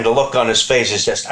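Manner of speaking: ordinary talk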